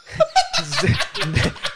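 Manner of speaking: a burst of high shrieking laughter; high squealing laugh. Real, not acted. Laughing high while telling friends about the frozen turkey. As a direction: laugh high and hard at the absurdity.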